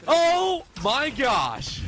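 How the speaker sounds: slow stunned exclamation; amazed. Real, not acted. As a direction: let it out in awe. Reacting to the clutch.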